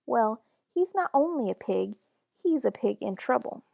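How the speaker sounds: ordinary delivery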